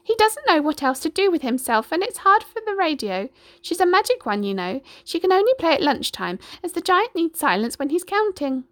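high, chirpy hen; chicken voice. A narrator's reading. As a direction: chatter like a friendly hen.

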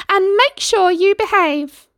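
high, loud mother calling after her son. A narrator's reading. Mother calls to her son riding off, high loud call. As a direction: call after him, fond and bossy. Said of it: "she called out".

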